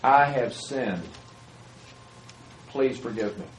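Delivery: slow, soft, earnest apology; sincere confession. Shown as a demonstration. Preacher showing how to confess to your child, slow and sincere. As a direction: say it slowly and mean it. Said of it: "then say this".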